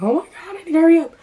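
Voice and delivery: high, rising panic near tears; panicked. Retold or imitated. Realizing she must leave fast, in a high panic. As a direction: burst out in frightened alarm. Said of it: "about to cry now".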